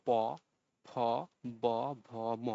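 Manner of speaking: "প, ফ, ব, ভ, ম" sounded with both lips meeting; bilabial consonants. Shown as a demonstration. Teacher shows students lip sounds, "প, ফ, ব, ভ, ম" made with lips together. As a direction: say "প, ফ, ব, ভ, ম" one by one, closing the lips. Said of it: "ঠোঁট দুটি পরস্পরের কাছে লেগে যায়".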